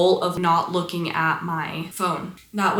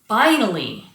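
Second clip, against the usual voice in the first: normal talking voice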